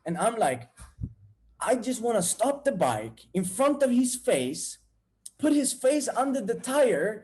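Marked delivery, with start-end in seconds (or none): 1.6-7.2 high-pitched vengeful acting; angry. Jealous rider behind the guy's car, acted anger. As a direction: build a heated imagined attack. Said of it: "That's how angry I became".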